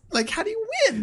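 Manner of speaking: very high-pitched, exasperated protest; squeaky disbelief in a petty squabble; incredulous protest. Acted. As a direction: challenge it, voice leaping way up. Squabble over a board game.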